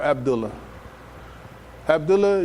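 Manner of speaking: normal talking voice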